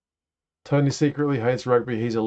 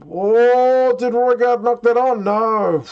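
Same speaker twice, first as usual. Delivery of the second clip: high, loud sudden gasp of worry; alarmed surprise; 10 semitones higher, 5 dB louder, slower than usual. Real, not acted. Fan suddenly fearing his halfback knocked it on, alarmed. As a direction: jolt up in alarm at the possible knock-on.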